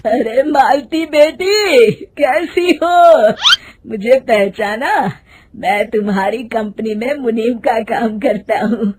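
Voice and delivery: loud, drawn-out greeting, then settling down; old man calling. Acted. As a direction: hail a young woman across the market as an old man. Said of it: "एक बूढ़ा आदमी उसे आवाज देता है". (पुकारता बूढ़ा आदमी)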